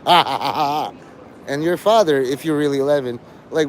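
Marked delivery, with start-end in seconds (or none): 0.0-0.9 staged ha ha ha, fading out; mock laughter. Streamer shows chat how they'd laugh, mock staged laughter. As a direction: fake a confused laugh. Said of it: "you'll be laughing like this".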